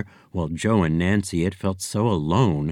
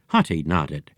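ordinary delivery

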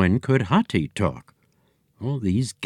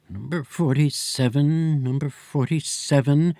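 higher, musing repetition of the number; mulling it over at the desk; repeating receptionist; 5 semitones higher than usual. Acted.

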